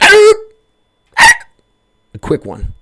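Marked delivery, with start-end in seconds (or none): 0.0-1.3 two sharp, very high, loud yelps; imitating the shot dog's cries for listeners, sharp yelps; imitated dog yelp. High pained yelps. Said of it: "It was like".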